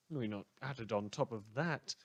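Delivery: normal talking voice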